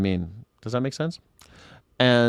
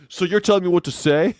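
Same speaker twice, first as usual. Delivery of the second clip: a touch higher, smug retort; mock gotcha comeback; 6 semitones higher, 4 dB louder than usual. Imagined caller mocking the host, smug. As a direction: turn the gotcha back on him. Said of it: "Yes, exactly".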